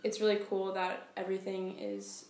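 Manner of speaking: ordinary delivery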